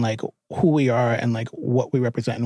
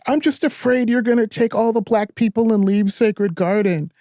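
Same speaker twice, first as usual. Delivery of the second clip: high, mildly whiny confession; higher-pitched mock crying voice, no real sobs; retold tearful plea; 7 semitones higher than usual. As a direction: imitate someone tearfully admitting a fear. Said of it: "he starts crying".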